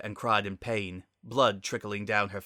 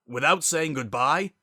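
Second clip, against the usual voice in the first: louder repeat, pushing "Without"; the second time with rising anger; 6 semitones higher, 7 dB louder than usual. Acted. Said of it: "Zephyr's voice rose".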